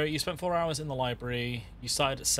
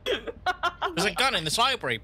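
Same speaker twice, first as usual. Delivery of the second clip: mock dramatic; 10 semitones higher, slower than usual. GM narrates a gunshot scene.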